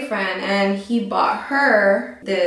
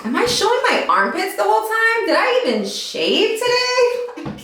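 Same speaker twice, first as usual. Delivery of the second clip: pitched way up, worried about armpits; high, fast embarrassed aside; high self-conscious aside; 12 semitones higher than usual. Real, not acted. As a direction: squeak the aside out.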